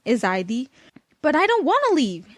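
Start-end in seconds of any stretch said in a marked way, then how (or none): 1.2-2.3 kind of crying. A narrator's reading. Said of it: "I said, kind of crying".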